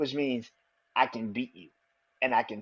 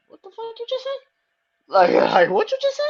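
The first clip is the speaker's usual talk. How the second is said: much higher, stunned, breaking into a laugh; can't believe what he just heard; incredulous; 6 dB louder than usual. Retold or imitated. Roman hearing Jay defy him, high incredulous outrage.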